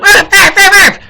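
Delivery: high, loud mock dog bark. Mocking Hillary's bark, high and loud. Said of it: "She went".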